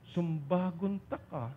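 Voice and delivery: soft and slow, like a tender threat; wife's mild Ilonggo threat, spoken gently and slowly; gentle Ilonggo phrasing. Retold or imitated. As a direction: say it softly and slowly, almost sweetly. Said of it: "yung malumanay pa".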